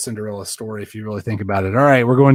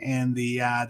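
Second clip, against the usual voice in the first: usual speaking voice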